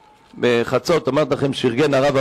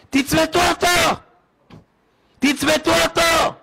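urgent shout; 11 semitones higher than usual. Retold or imitated. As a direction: bark the order to the staff. Doctor calling out in delivery room.

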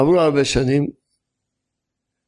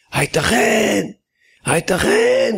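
mock shout; 8 semitones higher, slower than usual. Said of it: "צעק".

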